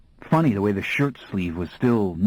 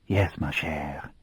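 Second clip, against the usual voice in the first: softer, lower and slow; real nice voice; 5 semitones lower, 5 dB quieter, slower than usual. A narrator's reading. Laurent soothing Baby Jenks in a soft voice. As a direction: gently soothe the frightened girl. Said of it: "he said in a real nice voice".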